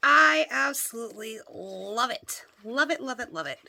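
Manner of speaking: loud, emphatic gush of love. Real, not acted. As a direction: repeat it with growing delight. Reacting to the gifted book.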